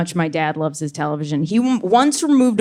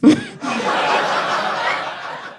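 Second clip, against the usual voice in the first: high-pitched burst of laughter; 4 dB quieter than usual. Real, not acted. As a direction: squeal with laughter. Big laugh after punchline.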